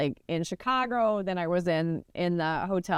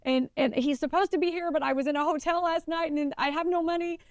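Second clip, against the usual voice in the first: crying ramble; 7 semitones higher than usual. Retold or imitated. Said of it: "I'm like".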